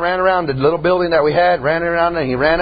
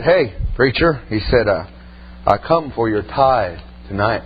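menacing stranger; 11 semitones lower, 3 dB quieter than usual. Retold or imitated. Demonic man demands the tithe.

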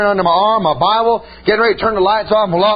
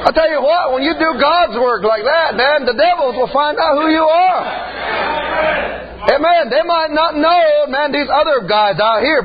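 preaching; 5 semitones higher than usual. Acted. Preacher rousing the congregation about the devils, raised and emphatic. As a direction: lift the congregation, higher and deliberate.

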